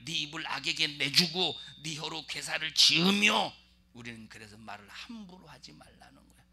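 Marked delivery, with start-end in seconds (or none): 0.1-3.5 verse repeated louder, pressing on 입을; 네 입을 악에게 내주고 said louder as a rebuke; emphatic repeat. Shown as a demonstration. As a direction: drive the verse home, preaching. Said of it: "입, 입을".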